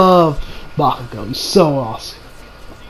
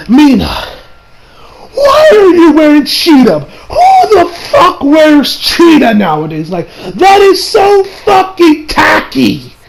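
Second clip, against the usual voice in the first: high, loud, slow exclamation; outraged disbelief; 8 semitones higher, 3 dB louder, slower than usual. Real, not acted. Vlogger ranting at Mina's cheetah print, slow high outrage. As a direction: drag out the shock at the tacky outfit. Exasperated rant at Mina's outfit.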